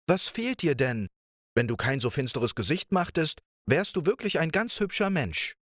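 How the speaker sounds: playful princess. Acted. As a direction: coax him like a carefree young princess. Princess chatters at Pechvogel.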